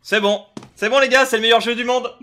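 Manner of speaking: loud, thrilled shout; excited cheering. Real, not acted. Gamer cheers a good pull.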